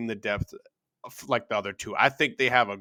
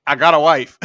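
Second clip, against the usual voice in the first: loud proud declaration; 2 semitones higher, 11 dB louder than usual. Retold or imitated.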